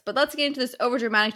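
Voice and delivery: ordinary delivery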